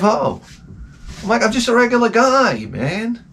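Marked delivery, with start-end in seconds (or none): 1.4-3.2 high-pitched defensive protest; mock pleading. Acted plea to suspicious neighbors.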